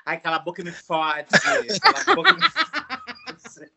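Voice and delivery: laughing, breathy bossy lover; sultry demand. Retold or imitated. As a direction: play a bossy partner, half laughing. Imitating a demanding partner in bed.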